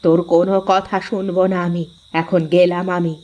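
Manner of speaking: mother warning son before she leaves; warning mother-in-law. Acted. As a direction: lay down the law as his mother. Mother-in-law warns her son.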